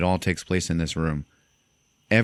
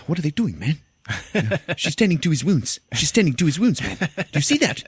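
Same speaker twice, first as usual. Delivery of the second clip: high, incredulous complaint; hammed-up indignant squawk; mock jealous outrage; 8 semitones higher than usual. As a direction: imitate a pissed-off man griping to his pal. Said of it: "he's pissed off".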